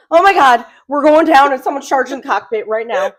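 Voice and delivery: high, loud panicked rush. Retold or imitated. Wife retelling her terror on the red eye, loud and panicked. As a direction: panic that the plane is going down. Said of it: "I'm like".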